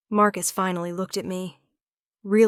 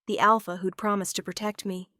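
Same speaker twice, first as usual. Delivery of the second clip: plain reading voice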